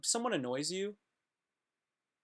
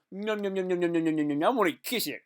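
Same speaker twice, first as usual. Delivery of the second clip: babbled, raised-voice gobbling; mock smooching fan; 4 semitones higher, 6 dB louder, faster than usual. Fan looking at the comedian's face, mock smooching. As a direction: play-act an overeager kisser closing in.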